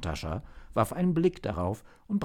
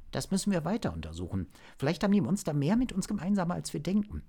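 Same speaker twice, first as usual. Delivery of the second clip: higher-pitched, quick agent; intrigued agent; 10 semitones higher, faster than usual. Acted. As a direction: urge further investigation with quick interest. (interessierter Agent, schnell und höher)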